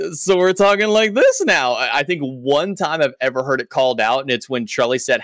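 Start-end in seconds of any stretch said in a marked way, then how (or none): 0.1-1.7 high, louder teasing disbelief; raised-eyebrow "really, now?"; mock disbelief. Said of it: "They're like".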